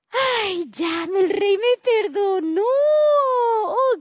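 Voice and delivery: a hint of teary relief; relieved joy. Forgiven servant to himself, lightly teary happy relief. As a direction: sigh it out, forgiven and moved. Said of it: "se puso muy contento y muy feliz".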